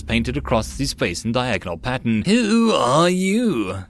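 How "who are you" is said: slow, high growling demand; growled question. Acted. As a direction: growl it out slowly. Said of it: "the Emperor growled his question".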